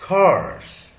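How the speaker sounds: "course" said with the R left unspoken; silent R. Shown as a demonstration. Teacher shows R after a vowel, silent R. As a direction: model "course" without sounding its R. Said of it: "course में भी र नहीं बोला जाएगा".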